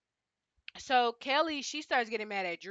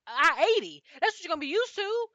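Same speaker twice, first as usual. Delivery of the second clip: loud, raised ranting voice; fired-up raised rant; heated rant; 4 semitones higher, 7 dB louder than usual. Real, not acted. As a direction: lay into her, fired up. Ranting at cast member Kelly.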